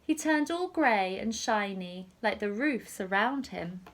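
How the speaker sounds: ordinary delivery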